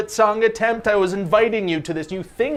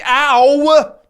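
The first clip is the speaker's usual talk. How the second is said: pained yelp; 8 semitones higher, 8 dB louder than usual. Real, not acted. Gets hit by hand thing.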